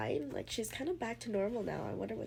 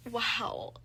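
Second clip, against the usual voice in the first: ordinary talk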